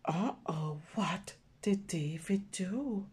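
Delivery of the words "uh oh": usual speaking voice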